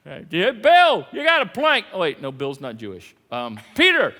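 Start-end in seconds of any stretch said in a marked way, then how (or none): 0.3-1.9 loud, high ribbing call; teasing jab. Retold or imitated. Teasing Bill in the joke, loud and high. 3.8-4.1 loud high call; teasing call. Retold or imitated.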